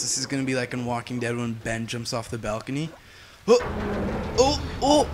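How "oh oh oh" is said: startled cries. Real, not acted. Character nearly falls off ledge.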